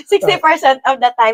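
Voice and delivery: laughing repeat. Real, not acted.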